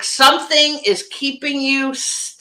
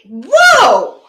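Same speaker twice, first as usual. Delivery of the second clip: a loud, drawn-out whoa of sudden realization; amazed whoa; 5 semitones higher, 7 dB louder than usual. Retold or imitated. Client realizing the world shifted, amazed. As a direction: let the lightbulb go on. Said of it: "she was like".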